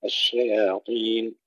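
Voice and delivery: الشياطين with light shin and ya and a heavy emphatic ṭa; thin الشي, thick طين; correct pronunciation. Shown as a demonstration. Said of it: "ترقيق الشين والياء وتفخيم الطاء". (ترقيق الشين وتفخيم الطاء في الشياطين)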